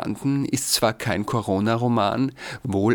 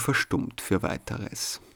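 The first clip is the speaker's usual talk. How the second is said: slow, softer whisper that falls silent; fading whisper; 5 dB quieter than usual. Acted. Narrator telling of the silenced door, slow fading whisper. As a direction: let the words go quiet like the door.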